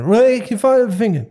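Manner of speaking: mock garbled yell, very high and loud; muffled shouting imitation. Husband mimics injured coworker interrupting his phone call, garbled mock shout. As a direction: mimic a frantic holler nobody can make out. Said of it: "I don't know what he's saying".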